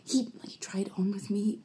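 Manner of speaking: soft secretive whisper. Retold or imitated. Teen girl whispers confession.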